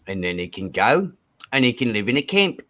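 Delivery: a fair attempt at an Australian accent. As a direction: lay on an Aussie accent. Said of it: "It's a pretty good accent".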